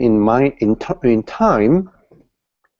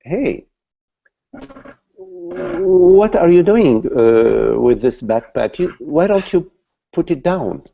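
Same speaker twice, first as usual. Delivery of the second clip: friend's question; 6 semitones higher, 4 dB louder than usual. Acted. Friend questions man with backpack.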